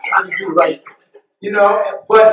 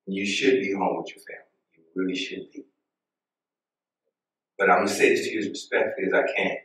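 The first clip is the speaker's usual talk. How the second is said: much lower and softer, gently firm; calm; 10 semitones lower, 11 dB quieter than usual. Retold or imitated. Preacher calmly telling the cashier not to take it out on her, softly. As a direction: soothe her while setting a boundary. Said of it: "I said it so calm".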